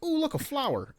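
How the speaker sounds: high, gushing "ooh" at a flower; a gamer cooing at every shiny thing; mock delight. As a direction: squeal with sudden distracted wonder. Voicing his distracted self.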